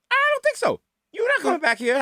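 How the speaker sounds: high-pitched mock disbelief; incredulous dismissal. Security guard to drunk customer, dismissive. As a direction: scoff at an empty threat, voice jumping up.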